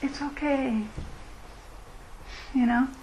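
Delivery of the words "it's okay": soft and reassuring "It's okay"; soothing reassurance. Shown as a demonstration. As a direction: breathe "It's okay" gently, like comforting a friend. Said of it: "Maybe it would be just".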